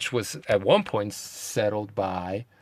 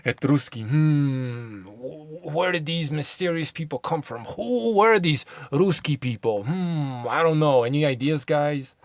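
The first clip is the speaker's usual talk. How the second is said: mock puzzlement; 3 semitones higher than usual. Asking viewers who these mysterious people were, mock-puzzled. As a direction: play innocently baffled, hamming up the mystery.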